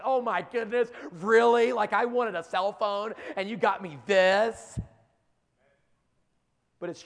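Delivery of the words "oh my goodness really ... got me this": loud, exasperated mock teenager; eye-rolling teen whine. Preacher mocking a teen unhappy with a Bible gift, loud and exasperated. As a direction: sneer at the gift like a spoiled kid. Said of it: "roll your eyes like".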